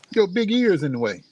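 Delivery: high, playful jab at the dog; high-pitched teasing of the dog. Real, not acted. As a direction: poke fun at her big ear in a high voice. Teasing the dog blocking view.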